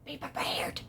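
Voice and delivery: hushed dramatic whisper; very soft, voiceless repeat; whispered warning. Pretend. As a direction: whisper it like an ominous echo.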